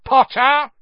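loud, high, sharp summons; stern teacher calling a pupil back. Acted. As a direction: bark his name down the corridor. Snape calls out from behind.

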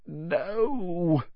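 high, strained croak; a small, high croaked protest. A narrator's reading. Harry refusing the house-elf, croaked. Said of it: "croaked Harry".

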